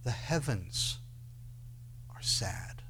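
soft, falling to a whisper; hushed sorrow. Acted. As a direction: let it sink into a sad hush. Sorrowful reading, dropping to whisper.

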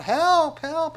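high, loud desperate cry for help. Acted. The sick and lame cry out to the marching crowd, a high loud plea. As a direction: call for help from the roadside, pitched high. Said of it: "and they're crying out".

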